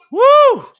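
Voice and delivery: a loud, high, excited whoop. Real, not acted. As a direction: let out a big, high woo. Reacting to the Irish commentator.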